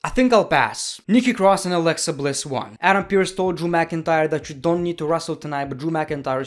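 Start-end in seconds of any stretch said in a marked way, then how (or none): none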